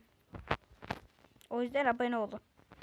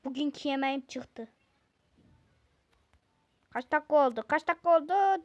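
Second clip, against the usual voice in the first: usual speaking voice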